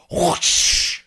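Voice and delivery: loud, airy whoosh sound effect; mouthed whoosh. Pretend. Host launching the candle segment, loud mouthed whoosh. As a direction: make a big whoosh with your mouth.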